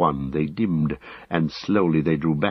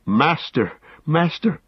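urgent high calls, climbing on the repeat; high-pitched urgent hail; crying out; 9 semitones higher than usual. A narrator's reading. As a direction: call after your master, pitch rising the second time. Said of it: "cried Sam".

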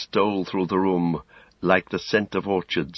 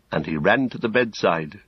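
usual speaking voice

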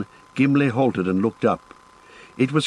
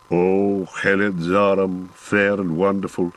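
reverent dwarf; 3 semitones lower than usual. Acted. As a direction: hail the lake like a sacred thing. Dwarf hails the sacred lake.

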